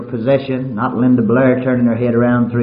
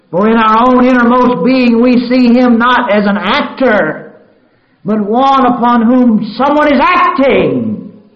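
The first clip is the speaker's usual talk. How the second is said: higher and louder, slowing into a ringing cry; full-force preaching; 11 semitones higher, 10 dB louder, slower than usual. Acted. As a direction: preach it out to the back row.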